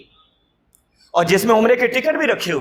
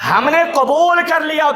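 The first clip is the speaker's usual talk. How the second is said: preached shout; 6 semitones higher than usual. Shown as a demonstration. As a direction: hammer قبول out to the crowd. Preacher repeats God's words louder.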